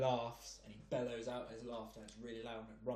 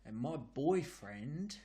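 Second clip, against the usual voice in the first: put-on London geezer accent; 2 semitones higher than usual. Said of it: "in a London accent, like a proper geezer".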